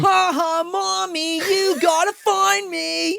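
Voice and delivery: put-on high, sing-out creepy kid taunt; taunting child. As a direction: taunt Mommy loudly, stretching the words. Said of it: "He's, like, doing that the whole time".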